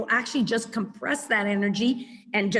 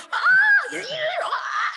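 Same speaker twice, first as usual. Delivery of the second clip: much higher, loud laughing mock scream; a hammed-up shriek of a dog losing it; imitated dog yelling; 4 dB louder, slower than usual. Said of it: "screaming and yelling".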